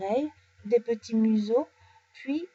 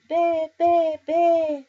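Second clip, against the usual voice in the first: put-on high, loud baby-lamb bleats; happy bleating lamb; 7 semitones higher, 7 dB louder, much slower than usual. A narrator's reading. The lamb plays with new friend Oscar, bleating happily. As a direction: bleat gleefully like a little lamb. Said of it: "bêle l'agneau tout content".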